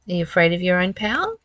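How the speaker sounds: ordinary talk, nothing marked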